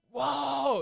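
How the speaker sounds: very high, put-on wowed cry; exaggerated awestruck whoa; mock amazement. Showing how his son won't react, mock amazement. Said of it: "not gonna be super like".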